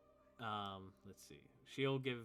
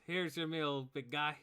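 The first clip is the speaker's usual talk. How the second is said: higher, louder hearty serving voice; friendly barkeep; 6 semitones higher, 9 dB louder than usual. Acted. Barkeep serving the lizardfolk, higher and louder. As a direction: serve it up cheerfully.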